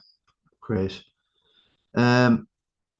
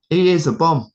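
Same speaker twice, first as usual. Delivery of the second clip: blurted insult; 7 semitones higher, 4 dB louder than usual. Real, not acted. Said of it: "Sorry. I've said it now".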